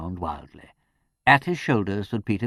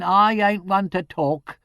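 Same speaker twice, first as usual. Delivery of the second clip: bridling; 11 semitones higher, 5 dB louder, slower than usual. A narrator's reading.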